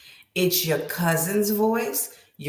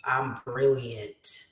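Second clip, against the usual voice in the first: self-satisfied; 8 semitones lower, 3 dB quieter, much slower than usual. Retold or imitated. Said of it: "You're gonna be like".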